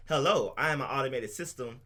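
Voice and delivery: usual speaking voice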